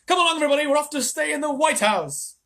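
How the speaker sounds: high, loud rallying cry; rally everyone joyfully; excited call. Acted. Wonka rallying.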